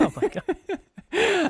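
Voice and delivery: high, slow shocked gasp. Real, not acted. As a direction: stretch out the shock. Reacting to crude joke.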